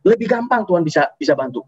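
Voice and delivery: ordinary delivery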